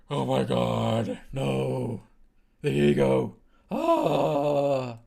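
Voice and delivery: loud, slow theatrical gasp and cry; mock ego panic. The dying ego crying out in loud mock horror. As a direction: wail as if your precious thing is being taken.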